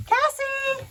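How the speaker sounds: high loud call across the yard. Real, not acted. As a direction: call her name from afar. Calling goat by name.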